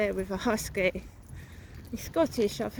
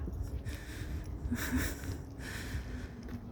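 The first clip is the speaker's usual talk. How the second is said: quiet unvoiced chuckle, long and airy; breathy laugh; 11 dB quieter than usual. Real, not acted. Vlogger chuckling to viewers about his cap nickname, soft breathy laugh. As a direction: laugh softly under your breath at your own joke. Laughs at own [name] joke.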